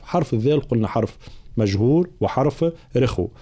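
ordinary talk, nothing marked

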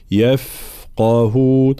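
"يفقهون" slowly, with a long streaming f; flowing fa. Shown as a demonstration. Teacher showing softness of fa, "يفقهون" with prolonged f. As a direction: let the sound run on the "ف" of "يفقهون". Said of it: "الفاء فيها صفة الرخاوة خاصة".

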